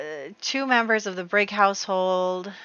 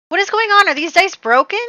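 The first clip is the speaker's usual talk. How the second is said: high, loud outburst at the dice; can't believe the rolls, cry it out; shocked disbelief; 10 semitones higher, 12 dB louder than usual. Real, not acted. Player yelling at broken-seeming dice in disbelief.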